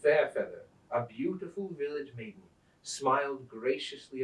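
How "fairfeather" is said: plain reading voice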